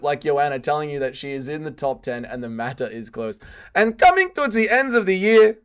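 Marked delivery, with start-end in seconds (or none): 3.7-5.6 higher, louder mock Russian woman; attempted Russian accent. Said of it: "I can't do a woman Russian accent".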